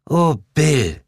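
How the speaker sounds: high, whiny complaint; a wife sulkily protesting; whining. A narrator's reading. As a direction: pout and whine at Bill. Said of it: "maulte Sheila".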